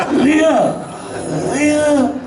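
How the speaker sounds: mock call to someone nearby, drawn out. Dying friend asks Leah to bring beers, calling out.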